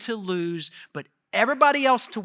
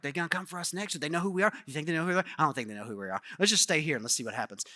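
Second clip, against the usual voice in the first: nervous hiding; 4 semitones lower, 6 dB quieter, faster than usual. Retold or imitated. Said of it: "they were all hiding and trying".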